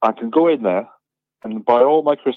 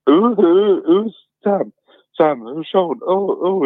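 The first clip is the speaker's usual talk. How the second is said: higher-pitched, lightly acted confusion; retold confusion; 7 semitones higher, slower than usual. Caller retelling a seven o'clock phone call, mildly acted confusion. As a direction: replay the muddled phone answer. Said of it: "and then I said".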